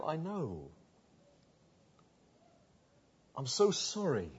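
soft, tender reassurance; tender comfort. Acted. Jesus comforting the deaf man.

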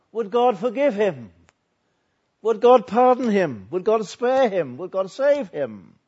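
high, loud volley of questions; impassioned pulpit cry; urgent preaching. Real, not acted. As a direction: hammer each question with mounting urgency. Preacher builds urgent rhetorical questions.

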